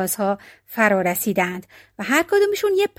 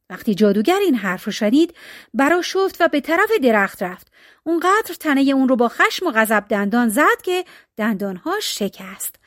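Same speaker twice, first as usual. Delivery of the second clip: usual speaking voice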